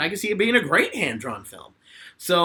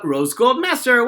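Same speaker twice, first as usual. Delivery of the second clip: ordinary talk, nothing marked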